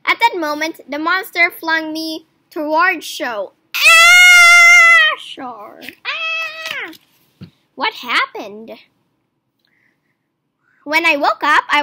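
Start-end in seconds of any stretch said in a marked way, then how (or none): 3.7-6.9 very high, loud falling scream; terrified scream. A narrator's reading. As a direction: scream as you're thrown through the air. Flung by the monster.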